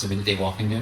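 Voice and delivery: usual speaking voice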